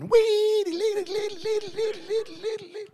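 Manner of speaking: high, loud sing-song wee dee lee; mock church shouting. Imitating the shouting at Church of God in Christ. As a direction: ham up a shouting churchgoer's chant. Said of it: "they're still shouting".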